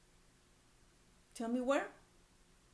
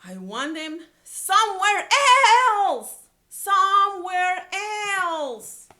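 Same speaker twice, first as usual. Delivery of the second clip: much louder, higher, slow yell; Gerald yelling; 8 semitones higher, 14 dB louder, slower than usual. A narrator's reading. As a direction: bellow it out like a cross elephant. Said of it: "Gerald likes yelling".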